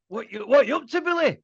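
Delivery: put-on a fat kid eagerly calling to his hero. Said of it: "I would always do".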